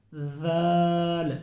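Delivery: जाल drawn out slowly, the tongue tip touching the teeth; slow careful zaal. Shown as a demonstration. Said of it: "ज़बान की नोक लगेगी".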